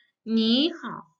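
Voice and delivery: "你好" slowly, rising then dipping; pronunciation demo. Shown as a demonstration. Teacher shows students how to say hello, "你好" slow and deliberate. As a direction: stretch "你好", up on 你, dip and rise on 好. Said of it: "and it's pronounced".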